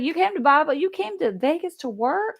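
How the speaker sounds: high, puzzled mock voice of a stranger; incredulous strangers. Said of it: "People are like".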